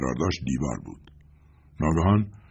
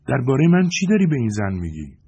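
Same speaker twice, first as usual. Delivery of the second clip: put-on child's voice; 8 semitones higher, 6 dB louder than usual. A narrator's reading. Child Katie questions suspiciously.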